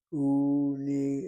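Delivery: Uni drawn out loudly as two separate syllables, U, NI. Shown as a demonstration. Teacher breaking down a borrowed word, Uni in stretched syllables. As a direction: sound out Uni loud and deliberate. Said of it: "and then the third syllable".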